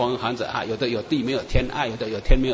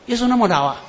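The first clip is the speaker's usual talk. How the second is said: raised, incredulous question; surprised; 8 semitones higher, 3 dB louder than usual. Retold or imitated. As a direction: disbelief at how old he looks. Shocked that Jesus looks old. (惊讶地反问)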